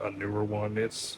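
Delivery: ordinary delivery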